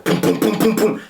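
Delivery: rapid, high-pitched booms mimicking a fist; imitated door pounding. Storyteller mimics late-night knocking at her house, imitated pounding. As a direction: punch out each boom like a knock.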